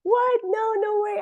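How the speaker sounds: very high, loud squeal of disbelief; stunned disbelief. Retold or imitated. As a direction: squeal it, overwhelmed by the gift. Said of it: "And I was like".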